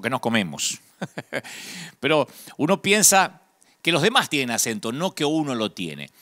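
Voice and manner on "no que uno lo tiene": ordinary delivery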